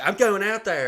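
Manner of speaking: loud, high imitation of a determined wife; defiant wife. Wife tells husband she's heading outside, loud high defiance. Wife announces she will go out.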